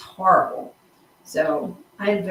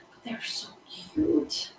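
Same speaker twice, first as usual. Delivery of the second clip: cooing; 9 semitones higher, 8 dB quieter, slower than usual. Real, not acted. Gushing over hedgehogs.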